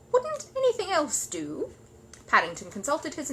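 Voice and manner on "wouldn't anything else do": anxious. Acted. Mrs Brown asking Paddington about the watch, anxiously. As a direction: plead nervously.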